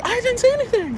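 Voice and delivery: high-pitched mock-defensive protest; retold indignant denial; protesting innocence. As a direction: squeal your innocence, hammed up.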